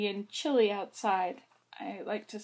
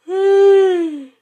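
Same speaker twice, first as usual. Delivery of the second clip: big exaggerated sigh; 10 semitones higher, 17 dB louder than usual. Mimicking a yawn story.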